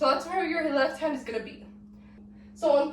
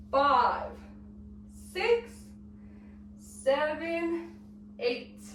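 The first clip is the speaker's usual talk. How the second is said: dance count; 5 dB quieter, much slower than usual. Shown as a demonstration. Teacher walking students through one eight count, slow sing-song count. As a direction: count each beat separately, pitch hopping up on the evens.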